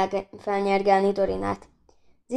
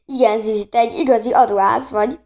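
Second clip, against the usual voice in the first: ordinary delivery, nothing marked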